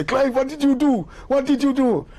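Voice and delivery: high, alarmed questioning; panicked. Retold or imitated. The French guide panicking at Clive, high and alarmed. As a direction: fret at him in alarm.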